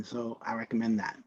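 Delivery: ordinary talk, nothing marked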